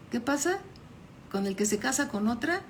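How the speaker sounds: ordinary talk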